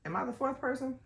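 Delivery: sudden realization. Real, not acted. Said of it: "Hold on, wait".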